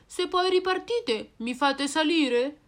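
voce più acuta, supplichevole; vocina di animale. Acted. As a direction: chiedi il passaggio con vocina alta. Personaggio chiede un passaggio. (vocina acuta che chiede un passaggio)

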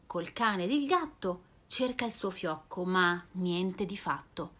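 ordinary delivery, nothing marked

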